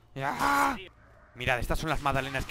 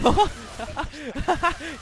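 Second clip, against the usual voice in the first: loud high laugh burst; 11 semitones higher, 6 dB louder than usual. Real, not acted. Risa fuerte.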